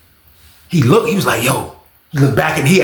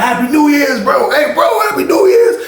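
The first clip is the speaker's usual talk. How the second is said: calling out; 11 semitones higher than usual. Retold or imitated. Inmates shouting New Year's greetings across the pod.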